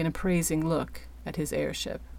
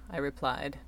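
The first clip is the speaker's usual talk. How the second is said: plain reading voice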